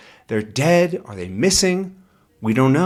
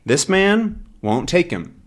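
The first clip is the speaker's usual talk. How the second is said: usual speaking voice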